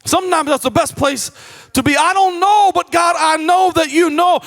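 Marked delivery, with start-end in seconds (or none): 2.0-4.4 higher and louder, a confident proclaimed prayer; bold declaration. Acted. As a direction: lift it up and declare it boldly to the congregation. Preacher models a prayer of trust.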